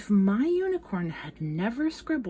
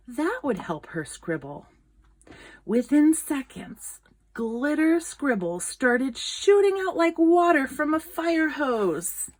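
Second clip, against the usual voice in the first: ordinary delivery, nothing marked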